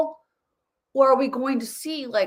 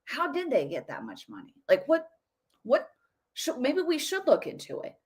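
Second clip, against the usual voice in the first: soft, hesitant musing to oneself; quiet wondering; 3 semitones higher, 7 dB quieter than usual. Retold or imitated. Imitating a lefty viewer starting to doubt, softly musing. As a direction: wonder it aloud under your breath. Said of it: "just a little bit of like".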